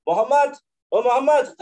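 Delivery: high calling out from outside the house; imitating visitors calling the Prophet loudly from outside; loud call by name. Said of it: "గట్టిగా పిలుస్".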